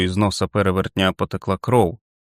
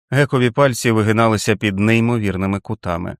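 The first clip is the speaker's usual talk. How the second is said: ordinary delivery, nothing marked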